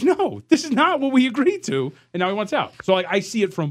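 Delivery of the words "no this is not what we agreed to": indignant player. Retold or imitated. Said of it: "Katie's like".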